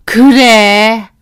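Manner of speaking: slow, loud, clipped yes; mock mother-in-law, drawn out and loud; curt reply. (느릿하고 크게 내뱉는 시어머니)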